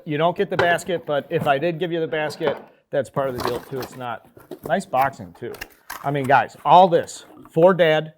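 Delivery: pumped-up pitchman selling a Father's Day box; enthusiastic ad read. Acted. Host pitching a Father's Day gift box to fans, excited ad read. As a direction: hype it like a loud sponsor spot. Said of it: "That was an enthusiastic ad read".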